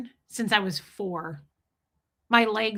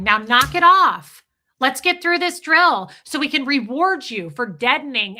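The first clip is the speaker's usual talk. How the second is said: stern supervisor; 4 semitones higher, 8 dB louder than usual. Retold or imitated. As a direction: snap at a squirming kid to stop. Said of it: "I had an adult supervisor going".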